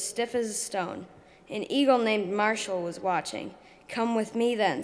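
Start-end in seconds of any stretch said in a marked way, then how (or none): none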